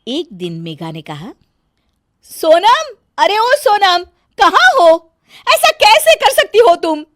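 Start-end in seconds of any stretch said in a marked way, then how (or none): 2.2-7.0 loud high shouting for Sonam; calling out. Acted. Bahu shouting for Sonam through the house, high loud call. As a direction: yell across the house.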